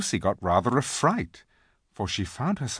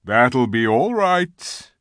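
slow, louder, confident reply; assured lion; 2 semitones higher, 6 dB louder, slower than usual. A narrator's reading. Aslan answers Tumnus about the locked gates, assured. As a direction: take your time and sound in command. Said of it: "said Aslan".

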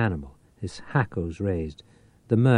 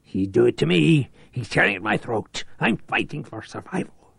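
raised, strained voice fighting for breath; desperate plea; 6 semitones higher than usual. A narrator's reading. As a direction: gasp it out, losing the fight. Desperate plea under attack.

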